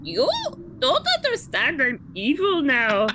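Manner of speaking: mock Irish accent. Brink announcing he's evil now in mock Irish. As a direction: put on a silly Irish lilt. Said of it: "Why is he Irish".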